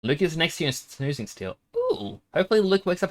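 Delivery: ordinary talk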